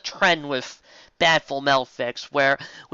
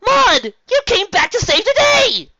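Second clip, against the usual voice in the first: loud, high, slow delighted cry; excited cheer; 8 dB louder, slower than usual. Real, not acted. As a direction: greet a rescuer with joy. Excited greeting of returning Maud.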